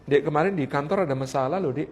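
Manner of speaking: lightly voiced husband, low and soft; suami bercerita. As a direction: quietly confide in the wife. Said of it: "Dia kalau cerita begini". (suami pelan bercerita, nada rendah)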